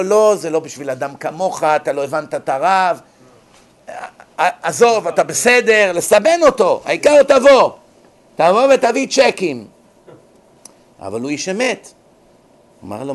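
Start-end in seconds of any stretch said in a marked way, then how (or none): 0.0-9.7 butter him up, mock wheedling; mock flattering rabbi. (חנפן מדומה, קול גבוה ומתחנף)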